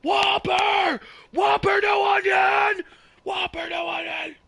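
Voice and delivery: comic shout of a fast-food order. Acted. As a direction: bellow the order across the kitchen. Drive-thru order bit.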